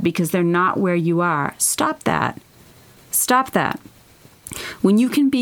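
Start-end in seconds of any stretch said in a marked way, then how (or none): 1.6-2.4 sharp, high "Stop that." with stress on "Stop"; firm stop. Real, not acted. Urging listener to stop self-shrinking. 3.1-3.8 steadier "Stop that." with weight on "Stop", less high; firm repeat. Real, not acted. Repeats the command more steadily.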